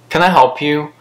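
ordinary talk, nothing marked

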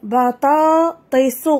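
بطاطس stretched slowly syllable by syllable; slow classroom model of بطاطس; slow model reading. Shown as a demonstration. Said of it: "إذن المقطع الثاني والثالث".

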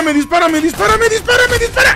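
high, fast panicked yelling. Real, not acted. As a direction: yell it in pure panic. Being shot in game.

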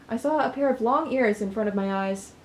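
usual speaking voice

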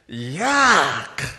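loud, drawn-out cry of revulsion; acted disgust. Man looks at the food on his table, loud acted disgust. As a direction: recoil loudly from the food. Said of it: "he said".

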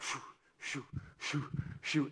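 soft shoo of passing cars; mimicking cars whooshing past an open window; imitating passing cars. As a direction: breathe out the car whoosh. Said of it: "they make that sound".

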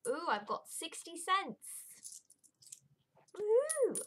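high, delighted squeal; excited cheer. Real, not acted. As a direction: cheer at the small find. Finding coins in purse.

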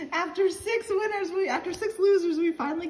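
high, giddy words through a laugh; laughing relief. Real, not acted. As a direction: chuckle through it, relieved at the win. Laughing after finally winning.

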